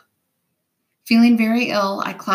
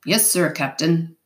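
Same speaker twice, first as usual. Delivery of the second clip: mildly meek reply; a touch of timid obedience; meek answer. A narrator's reading. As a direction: answer the captain submissively. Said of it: "A voice answered meekly".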